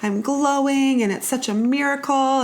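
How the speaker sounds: high sing-song mocking voice; mocking gushy voice. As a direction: parody the glowing mom. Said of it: "everyone I talked to was like".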